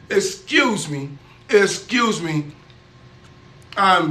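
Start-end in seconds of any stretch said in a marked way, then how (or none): none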